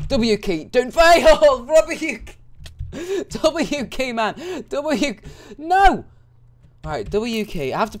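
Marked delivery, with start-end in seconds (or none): 0.1-6.0 high, loud, frantic pleading; frantic panic. Real, not acted. Gamer begging the W key not to fail, frantic and loud.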